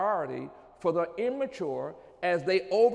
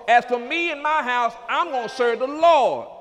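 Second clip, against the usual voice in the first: loud proclamation; 4 semitones higher, 8 dB louder than usual. Real, not acted. Declared loudly.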